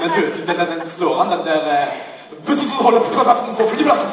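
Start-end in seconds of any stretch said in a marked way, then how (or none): 2.5-4.1 fast, high, shouted lisping tongue-twister; double lisp. Shown as a demonstration. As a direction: rattle it off loudly, sputtering every S.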